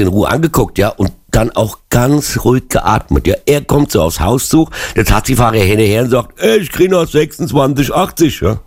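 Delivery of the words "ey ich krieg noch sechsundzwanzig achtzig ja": lightly voiced taxi driver, pitched up; a higher-pitched, mock demand for payment; taxi driver demanding money. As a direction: call after a customer who hasn't paid. Acts taxi driver demanding his fare.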